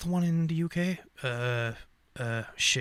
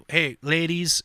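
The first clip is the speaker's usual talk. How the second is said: ordinary talk, nothing marked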